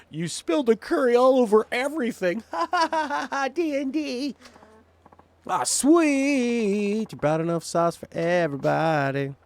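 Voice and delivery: very high, loud hammed-up dungeon master voice; goofy game master. Player narrating curry spill as a hammy game master, high and loud. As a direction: ham it up like a giddy game master narrating a fumble. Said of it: "It's like a D and D game".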